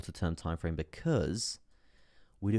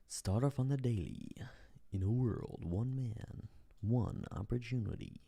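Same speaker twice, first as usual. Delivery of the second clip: slow, higher put-on trailer narration; mock movie-trailer voice; 4 semitones higher, 3 dB quieter, slower than usual. Streamer opens the daily chart like a film, mock trailer voice. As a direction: draw it out like a hammed-up trailer.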